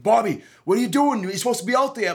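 a worker surprised he's still backstage; surprised staffer. Retold or imitated. Staffer comes to fetch him.

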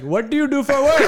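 mock Indian accent, high and loud; hammed-up Indian-accented crowd work question. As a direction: imitate a comic's put-on Indian accent. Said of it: "but in an Indian accent".

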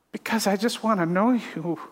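ordinary talk, nothing marked

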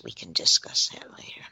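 hushed, secretive whisper. Real, not acted. Hushing a sensitive topic with the listener, whisper. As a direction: keep it between us, whispered.

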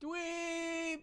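high drawn-out call of "Dweeb!"; calling a name into the woods. Acted. Kid calling into the woods for Dweeb, high and drawn out. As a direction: shout it high into the trees.

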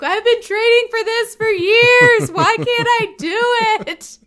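loud, high mock wail; hammed-up despairing whine; exhausted Olympians. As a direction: cry it out like a worn-out athlete. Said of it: "the Olympians are exhausted".

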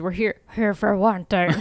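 menacing villain growl. Acted. Companion in the dark stating his purpose, growling. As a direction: growl the words from the throat. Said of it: "That's my growl".

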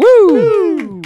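high loud cheer; excited whoop. Real, not acted. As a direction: whoop it out with glee. Cheering the show's end.

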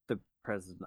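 ordinary delivery

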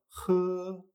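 J as a gentle, sustained h sound; very soft J. Shown as a demonstration. Teacher showing the Chinese H, J softened and held. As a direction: breathe out the J lightly, without scraping. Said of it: "pero bastante más suave".

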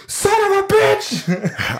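very high, drawn-out slow cry of delight; ecstatic outburst. Retold or imitated. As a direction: yelp it out like the theater just erupted. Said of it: "And you're like".

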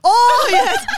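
excited. Real, not acted. Recognises the Taken reference.

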